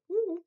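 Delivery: loud high whoop; loud excited woo. Real, not acted. Excited cheer about goal.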